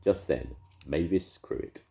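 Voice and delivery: ordinary delivery, nothing marked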